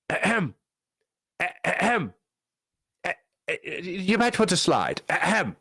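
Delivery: dainty English throat-clearing and polite hint; English accent. Acted. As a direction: put on a prim, subtle English accent. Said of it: "I gave him an English accent".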